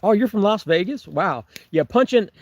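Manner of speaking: ordinary talk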